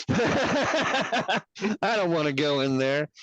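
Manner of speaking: hammed-up reluctant whine; mock whiny repeat. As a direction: draw it out like a sulky kid. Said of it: "And I immediately go".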